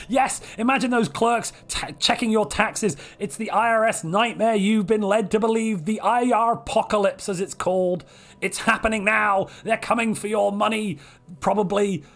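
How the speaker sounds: mock doom. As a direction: scare them about the tax clerks in jest. Mock doom about tax clerks.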